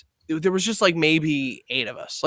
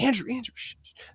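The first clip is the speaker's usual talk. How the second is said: hushing; 7 semitones higher, 9 dB quieter than usual. Retold or imitated. Friends hushing so girlfriend won't hear.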